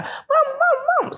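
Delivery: dad mimics the kids' shrill wail; mocking high mama cry. Dad mocking the kids' cries for mama, very high. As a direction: squeak it out high, mocking the children. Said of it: "He said".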